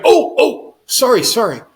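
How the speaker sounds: oh no, that came out wrong; flustered apology. Retold or imitated. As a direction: blurt it out, mortified at the slip. Doctor flustered, realizing his blunder.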